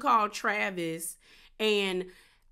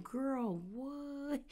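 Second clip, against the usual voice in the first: hushed disbelief; 4 semitones higher, 9 dB quieter than usual. Retold or imitated. Cast stunned by Jen's story.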